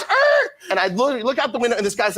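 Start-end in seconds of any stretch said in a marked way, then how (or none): 0.0-0.5 very high, loud tire screech; screeching brakes noise. Retold or imitated. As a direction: imitate brakes squealing to a stop. Imitating screeching car brakes.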